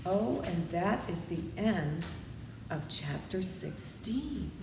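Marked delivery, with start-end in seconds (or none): none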